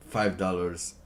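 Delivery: ordinary talk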